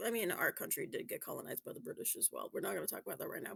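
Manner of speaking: quiet aside. Real, not acted. Sensitive aside, lowered voice.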